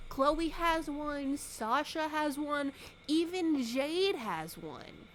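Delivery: high, slow whining list; whiny listing. Retold or imitated. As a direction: drag it out like a pestering kid. Kid pestering mom for bracelet.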